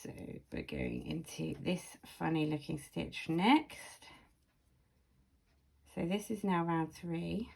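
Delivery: normal talking voice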